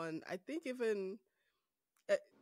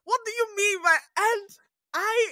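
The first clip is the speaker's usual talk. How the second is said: mock preachy mom; 13 semitones higher, 12 dB louder than usual. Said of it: "laughing, mom went off".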